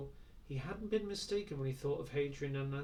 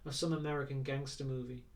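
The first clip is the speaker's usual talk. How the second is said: usual speaking voice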